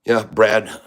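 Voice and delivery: ordinary delivery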